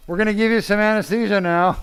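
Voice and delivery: high, loud announcement; calling out to patient. Real, not acted. Surgeon tells his waking patient about anesthesia, high and loud. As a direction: make sure the groggy patient hears.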